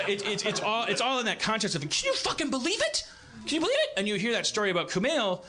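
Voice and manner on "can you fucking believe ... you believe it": mock disbelief, much higher, rising at the end; voice going up. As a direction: squeak out the outrage in a high, rising tone. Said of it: "your voice keeps going up in the air".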